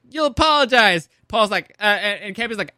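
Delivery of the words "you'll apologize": stern Mother. Retold or imitated. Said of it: "And Mother's like".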